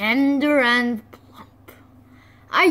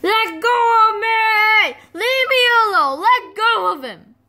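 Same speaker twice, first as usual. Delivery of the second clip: high, loud frantic yells; panicked shouting; 10 semitones higher, 5 dB louder than usual. Acted. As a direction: shout to get free. Struggle with clinging chicks.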